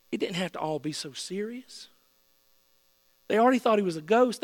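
normal talking voice

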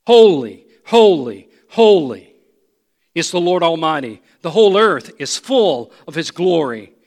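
loud, ringing proclamation of praise; calling out. Acted. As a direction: call each holy out forcefully, with space between. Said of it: "they were calling to one another".

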